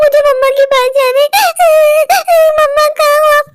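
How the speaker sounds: put-on high, wailing little-girl voice; acted childlike crying; crying child. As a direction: sob out for mummy like a frightened child. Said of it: "क्यों रो रही हो".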